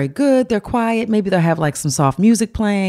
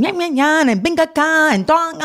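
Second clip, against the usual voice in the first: gibberish argument voice, pitched up; mock Spanish argument; 9 semitones higher than usual. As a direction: imitate two workers bickering. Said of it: "They were like".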